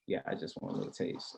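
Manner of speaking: whispered come-on. Retold or imitated. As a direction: breathe it close. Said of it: "I'd be like".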